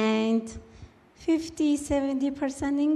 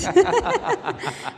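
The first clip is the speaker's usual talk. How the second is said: a real burst of laughter, pitched up; bright high laugh; 9 semitones higher, 5 dB louder than usual. Real, not acted. Laughing about parents who wanted boys, a real high laugh. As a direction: let the laugh spill out high. Laughs at having six sisters.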